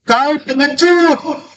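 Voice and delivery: high, sustained long-distance holler; storytelling yell. A narrator's reading. Child yelling at the unseen voice, acted high holler. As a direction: holler it out slowly on one high note. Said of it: "anak ini berteriak lagi".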